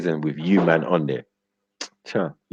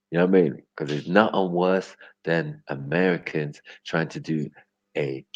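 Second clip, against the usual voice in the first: usual speaking voice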